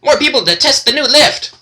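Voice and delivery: very high excited gnome squeal. Acted. Gnomes greet the party at the lift, high squeal. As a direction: squeal it out like thrilled little gnomes. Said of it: "Other gnomes squeal".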